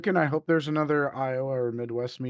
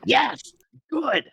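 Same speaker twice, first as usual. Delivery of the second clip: excited cheer; 11 semitones higher, 7 dB louder than usual. Real, not acted. Cheering correct pronunciation.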